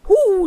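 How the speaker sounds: high, loud shuddering hoo; horrified shriek. A narrator's reading. Princess horrified after throwing the frog, loud high shriek. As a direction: shriek Hu in horror. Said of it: "dachte sie".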